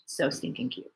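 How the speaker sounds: whispered, breathless gush; repeating it in a whisper over a cute video. Real, not acted. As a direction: whisper it like it's too cute to say aloud.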